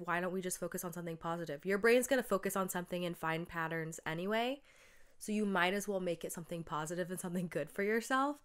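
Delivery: normal talking voice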